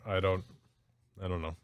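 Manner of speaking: normal talking voice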